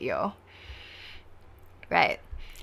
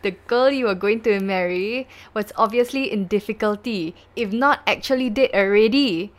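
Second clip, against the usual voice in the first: loud, raised accusing courtroom voice; questioning prosecutor; 4 semitones higher, 7 dB louder than usual. Acted. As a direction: drive the accusation home phrase by phrase. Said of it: "the prosecution".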